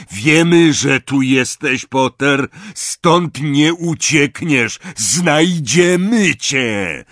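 loud, high, slow menacing shout; threatening call. Acted. Death Eater threatens hidden Potter, shouting slowly. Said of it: "usłyszeli głos".

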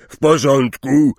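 loud, high friendly question; big bluff hello; hearty greeting. Acted. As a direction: hail an acquaintance heartily. Hagrid greets another centaur.

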